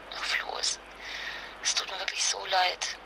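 breathy hushed whisper, barely voiced; whispered apology. [name] whispers her apology to Flo about his mother. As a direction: whisper it like you can hardly bear to say it.

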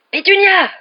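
very loud, high-pitched yell; furious roar. A narrator's reading. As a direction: bellow for his wife in alarm. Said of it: "rugit l'oncle Vernon". (rugissement furieux)

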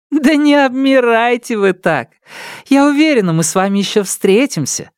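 high, playful teasing voice; laughing at his awkwardness; teasing young lady. Acted. Nelidova teasing. (игривый девичий голос)

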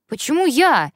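high, loud indignant outburst; indignant protest. Acted. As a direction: protest it's unfair. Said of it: "возмутился парень".

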